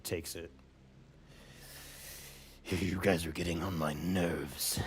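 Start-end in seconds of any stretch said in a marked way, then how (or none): 2.6-4.8 slow, low creature voice; annoyed monster, low and slow; menacing Fathomless. Acted. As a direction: drag it out low and soft like a weary monster. Game master voices the Fathomless.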